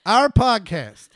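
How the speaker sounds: "Our podcast" said slowly, each word placed with care; slow down and reset on "Our podcast"; slow, deliberate restart. Shown as a demonstration. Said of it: "if I get off the rails, it's hard for me to get back on".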